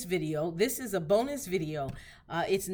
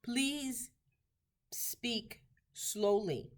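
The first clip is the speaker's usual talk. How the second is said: slowly; 4 semitones higher, 3 dB quieter, much slower than usual. Shown as a demonstration. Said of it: "would like to be able to say".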